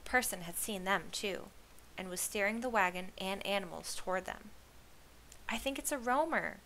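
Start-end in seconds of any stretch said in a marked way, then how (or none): 5.5-6.6 higher, eager guess; excited cry. A narrator's reading. Torrin guessing who approaches, excited cry. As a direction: cry out a hopeful sighting. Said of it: "cried Torrin".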